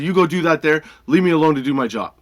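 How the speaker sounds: emphatic brush-off, re-enacted; forceful dismissal. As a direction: send the complainer away firmly. Retold telling-off ends.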